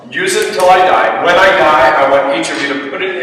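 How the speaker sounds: lightly voiced millionaire laying down terms; joking millionaire.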